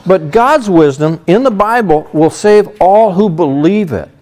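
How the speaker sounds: ordinary talk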